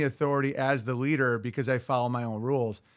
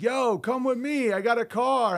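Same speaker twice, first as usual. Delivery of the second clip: swaggering guy; 8 semitones higher than usual. Retold or imitated. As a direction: play the smooth guy who gets the girl. Imitating a confident guy picking up women.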